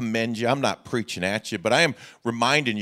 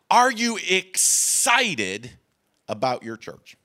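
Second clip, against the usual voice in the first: drawn-out excited question; 6 semitones higher, much slower than usual. Real, not acted. Preacher asking congregation with emphasis.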